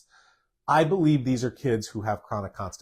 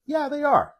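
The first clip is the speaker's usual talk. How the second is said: yes pitched way up high; 14 semitones higher than usual. Real, not acted. Host answering a viewer about Epsom baths, high-pitched yes. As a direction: sing the yeah right up in a high squeak. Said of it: "I say that with a, a high tone".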